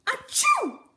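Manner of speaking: a loud, high, exploding fake achoo; big sneeze. Flea sneezes in the story.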